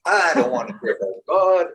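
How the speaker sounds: ranting like she's sick of it all; mock angry rant. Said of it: "one time she got so mad".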